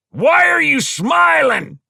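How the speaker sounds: slow, high-pitched angry shout; roared challenge, stretched out; thundering. Acted. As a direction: thunder it out as a loud demand. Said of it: "thundered Mo".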